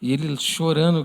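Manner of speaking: normal talking voice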